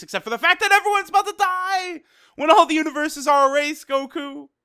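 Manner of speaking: high, loud, rushed mock yell; exasperated outburst. Host scolding Goku over erased universes, high exasperated yell. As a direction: burst out in hammed-up alarm.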